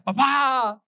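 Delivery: put-on high, frightened shout of a little boy; child's scared yell. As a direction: cry out for dad like a scared kid. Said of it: "me empezó a gritar". (grito asustado de niño pequeño)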